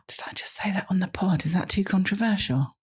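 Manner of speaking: gasp, then a hushed whisper; mock-shocked whisper. Co-host owns up on the podcast, mock-shocked whisper. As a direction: whisper it as if scandalised.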